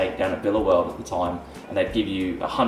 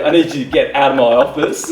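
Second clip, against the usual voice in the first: louder, slightly raised self-important order; mock stern demand; 5 semitones higher, 6 dB louder than usual. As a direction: act the indignant office boss. First take of retold demand.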